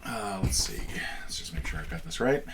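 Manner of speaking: whispered to self; whispering. Real, not acted. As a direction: whisper while checking. Whispering while checking rules.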